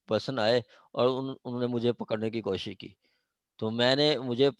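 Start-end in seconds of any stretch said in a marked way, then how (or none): none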